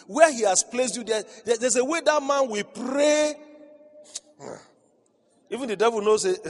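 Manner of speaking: full-force preacher climax; preaching climax. Acted.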